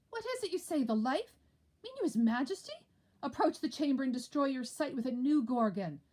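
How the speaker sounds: high, startled disbelief; shocked question. Acted. Lords reacting in shock to the news. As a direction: ask in shock.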